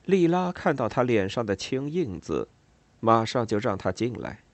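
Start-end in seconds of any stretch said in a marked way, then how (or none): none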